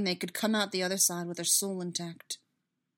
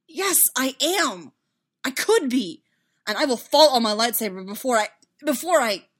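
loud, raised, anguished outburst; distraught vow, building in urgency; desperate insistence; 5 semitones higher, 10 dB louder than usual. Acted. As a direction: insist wildly, then break off. Anguished self-accusation in a quarrel.

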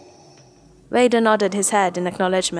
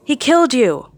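higher, louder anguished howl; 6 semitones higher, 5 dB louder than usual. A narrator's reading. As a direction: cry it out like a betrayed grandson. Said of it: "Ben howled".

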